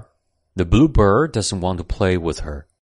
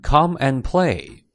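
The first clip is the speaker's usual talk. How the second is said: usual speaking voice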